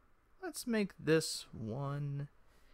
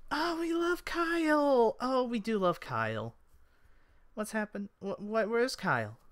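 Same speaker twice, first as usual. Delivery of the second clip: very high elf voice, easing into concern; high, adoring elf fan; gushing elf; 10 semitones higher than usual. Acted. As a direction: gush high-pitched, then ask worriedly.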